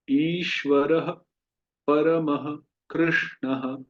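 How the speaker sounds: slow, measured Sanskrit chanting; shloka recitation. Acted. Teacher reciting a shloka for students to repeat. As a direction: chant each word slowly for students to repeat.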